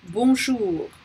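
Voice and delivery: bonjour with the final R clearly sounded; final R pronounced. Shown as a demonstration. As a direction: say bonjour, voicing its closing French R. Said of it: "we do pronounce that R".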